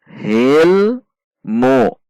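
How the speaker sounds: "hilmu" with a held L running smoothly into mu; slow "hilmu", L sustained; no bounce. Shown as a demonstration. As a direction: hold the L in "hilmu" without a rebound. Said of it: "ada pantulan".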